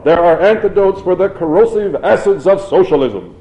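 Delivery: high, loud oratorical shout; ringing protest from the floor. A narrator's reading. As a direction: declaim it loudly over the applause. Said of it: "she shouted".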